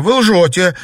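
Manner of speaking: high, loud gasped refusal; shocked denial. A narrator's reading. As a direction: refuse to believe it. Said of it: "побледнев выдохнула Наташа".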